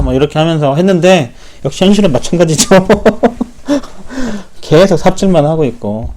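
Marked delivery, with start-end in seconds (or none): 2.7-4.6 rueful laugh. Real, not acted. Laughs at futile effort.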